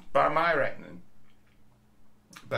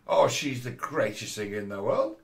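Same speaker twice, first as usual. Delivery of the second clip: mocking admirer, "world" swooping sharply up; mock uptalk; 3 dB louder than usual. Mocks worship with end inflection.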